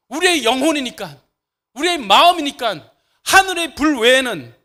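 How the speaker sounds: loud, high, fired-up preaching; excited preaching. Real, not acted. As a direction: preach it at full force, rising. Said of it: "내가 흥분하면 소리가 높아지는데".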